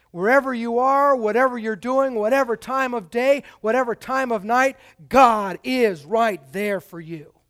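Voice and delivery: loud, ringing preacher's build through the list; full-force preaching. Acted. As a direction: preach it out to the whole room, swelling.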